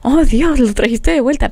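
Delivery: astonished relief. Retold or imitated. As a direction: re-enact a startled prayer of thanks. Retelling her reaction to car returning.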